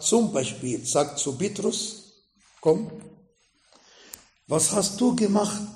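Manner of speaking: ordinary delivery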